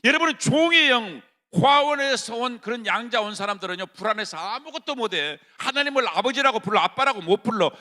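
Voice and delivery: loud, high sermon cry; fervent shouted preaching; full-force preaching. Acted. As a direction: preach it hard to the congregation.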